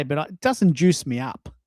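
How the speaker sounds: normal talking voice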